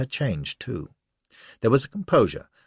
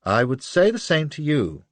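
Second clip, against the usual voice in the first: cavalier; 8 semitones higher, 5 dB louder than usual. A narrator's reading. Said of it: "cavalier as be damned".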